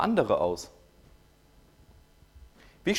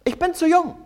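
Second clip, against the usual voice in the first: mock whiny protest; 12 semitones higher, 4 dB louder than usual. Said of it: "Er sagt".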